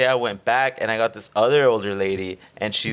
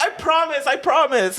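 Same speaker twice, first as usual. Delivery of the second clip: eager promise. Retold or imitated. Promising the examiner.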